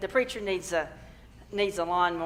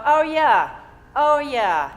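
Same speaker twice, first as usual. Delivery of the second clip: high, loud falling "oh yeah"; dawning realization; 6 semitones higher, 7 dB louder, slower than usual. Acted. Speaker acting out remembering one's power, loud realization. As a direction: exclaim as it comes back to you.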